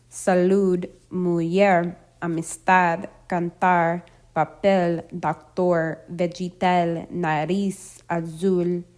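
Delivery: last syllable stressed in Salud, mujer, amistad, cantar, papel, doctor, vegetal, nariz, azul; word list stressed at the end, saLUD, muJER, amisTAD, canTAR, paPEL, docTOR, vegeTAL, naRIZ, aZUL; final-syllable stress. Shown as a demonstration. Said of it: "stress on the final syllable".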